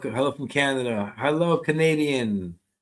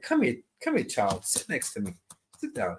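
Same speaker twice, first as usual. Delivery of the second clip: coaxing, dropping softer to a hush; tender grandma; 7 dB quieter than usual. Retold or imitated. Medium voicing grandma inviting the child to sit, tender. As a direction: call the child close and settle her. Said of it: "grandma would be like".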